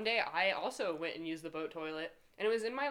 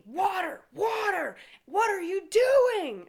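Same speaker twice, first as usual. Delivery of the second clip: high, loud drawn-out screech; imitated screaming; 13 dB louder, slower than usual. Boss on the phone screaming at worker washing hands, shrill yell. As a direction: mimic a boss shrieking across the shop. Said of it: "screaming at me".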